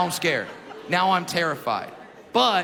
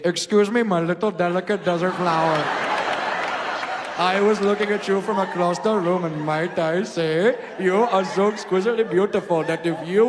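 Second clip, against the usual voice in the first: put-on louder, lilting Indian accent; 4 dB louder than usual. Indian suitor flirting with a lady in Indian accent. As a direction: woo her in a loud Indian accent.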